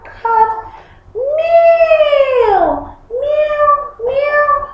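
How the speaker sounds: high, squeaky meows; cat meow. Shown as a demonstration. Teacher recapping animal sounds, high meowing. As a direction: mew like a little cat, up high.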